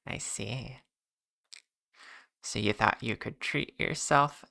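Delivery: soft whispery ASMR voice; breathy soft-spoken ASMR voice. Pretend. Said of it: "that's enough A S M R voice for me".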